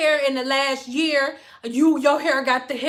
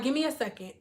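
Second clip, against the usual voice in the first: much softer, holding back anger; quiet; 10 dB quieter, faster than usual. Retold or imitated. Leaving calmly, holding anger.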